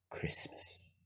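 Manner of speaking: very soft whispered reveal; barely audible whisper. Acted. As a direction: whisper it as the final clue. Villain's final whispered reveal.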